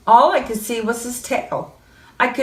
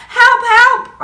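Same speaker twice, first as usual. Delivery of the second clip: high, loud cry of help; panicked cry for help; 6 dB louder than usual. Acted. Boy phoning the policeman, crying for help loudly. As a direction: call out in panic. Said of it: "I said".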